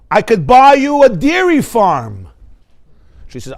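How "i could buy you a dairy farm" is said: boastful husband. Retold or imitated. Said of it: "He's like".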